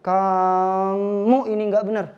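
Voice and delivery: "Kamu ini nggak benar." slow, vowels drawn out; stretched in annoyance. Shown as a demonstration. Annoyed speaker stretches vowels, slow. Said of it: "karena antum marah, antum sebel".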